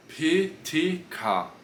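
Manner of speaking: P T K spelled slowly with strong puffs; slow, punchy P T K; explosive P T K. Shown as a demonstration. Teacher drills the class on aspirated P T K. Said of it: "Mais explosivo".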